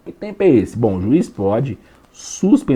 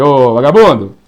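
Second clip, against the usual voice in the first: a judge yelling at the defendant; stern judge scolding; 3 semitones higher, 6 dB louder than usual. Acted. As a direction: play the judge dressing down the accused, loud. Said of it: "Aí o juiz vai falar pra ele".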